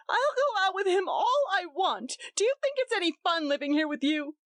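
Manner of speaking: high wild defiance. A narrator's reading. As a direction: rage back at him. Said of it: "cried Gretchen wildly".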